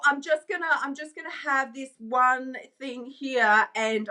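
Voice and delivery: usual speaking voice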